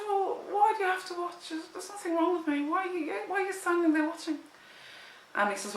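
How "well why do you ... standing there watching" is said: high, fast, exasperated pleading; indignant protest. Retold or imitated. Woman protesting to the cop at the door, high and exasperated. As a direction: push back, appalled at being watched. Arguing with cop watching her change.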